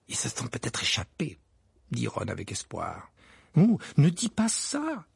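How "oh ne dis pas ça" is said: put-on much higher, slow alarmed girl; shuddering. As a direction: plead with a shiver. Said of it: "s'exclama Hermione, parcourue d'un frisson".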